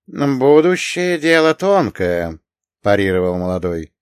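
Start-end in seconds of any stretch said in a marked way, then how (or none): 0.1-2.4 higher-pitched, unhurried parry; slow, unruffled reply; even, almost lazy. A narrator's reading. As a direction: draw it out with lazy ease. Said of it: "Его голос звучал ровно, почти лениво".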